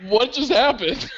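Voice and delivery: baffled. Retold or imitated. Guy finds them both gone, baffled. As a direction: drag it out, stunned.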